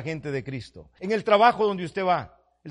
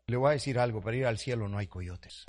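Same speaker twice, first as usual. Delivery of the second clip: normal talking voice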